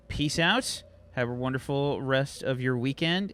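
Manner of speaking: ordinary talk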